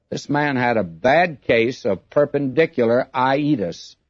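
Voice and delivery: normal talking voice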